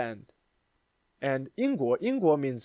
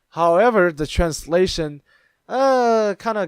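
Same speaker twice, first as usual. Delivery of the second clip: excited; 6 semitones higher, 8 dB louder than usual. Real, not acted. Building to the punchline.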